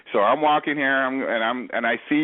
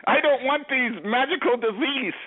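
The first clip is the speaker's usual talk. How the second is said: mock panic; 12 semitones higher than usual. Gangster panics over disease.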